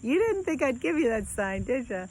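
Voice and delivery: high, laughing tease, imitating a joker; teasing laughter. Late partner teasing her from beyond, laughing high. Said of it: "cracking up laughing".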